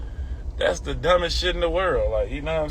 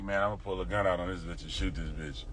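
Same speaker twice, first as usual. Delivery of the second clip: much softer, low imagined thought; hushed, lower mock of the shooter's thinking; mock inner thought; 9 semitones lower, 13 dB quieter than usual. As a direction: mutter it under the breath as a dumb idea. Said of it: "Never will I ever think".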